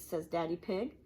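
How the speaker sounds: ordinary delivery, nothing marked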